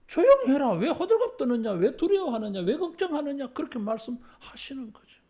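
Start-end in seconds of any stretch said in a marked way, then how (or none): 0.1-3.5 꾸짖는 예수님. Acted. 예수님이 제자들을 꾸짖음.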